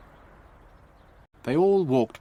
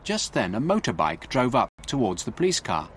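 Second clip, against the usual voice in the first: usual speaking voice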